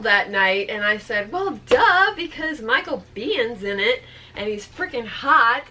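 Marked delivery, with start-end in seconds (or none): 1.3-5.6 bold. Retold or imitated. Fan tells friend why she came, bold. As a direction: blurt it out brazenly, as if obvious. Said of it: "I was feeling, like, bold".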